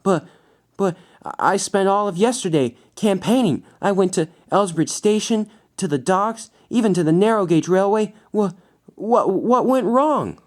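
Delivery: high, stammering disbelief; shocked. A narrator's reading. Losing candidate protesting the result, shocked and high-pitched. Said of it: "Mike was in shock".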